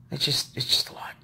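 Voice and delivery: breathed out, almost no voice; weary whisper. Real, not acted. Worn down by the day, whispering to viewers. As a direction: let it slip out as a worn-out whisper. Overwhelmed sigh about her day.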